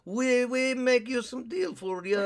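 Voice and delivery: loud, hammed-up Russian accent in broken English; bad Russian accent. Hawaii pitching a deal to Joe Biden in a bad Russian accent. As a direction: ham a clumsy Russian accent. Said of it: "I can't do a Russian accent".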